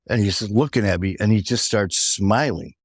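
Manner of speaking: ordinary talk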